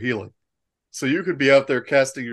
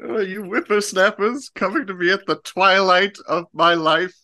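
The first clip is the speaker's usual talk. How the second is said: put-on very old man; 7 semitones higher than usual. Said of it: "you're gonna be an ancient old man".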